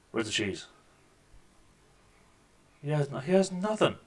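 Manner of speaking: normal talking voice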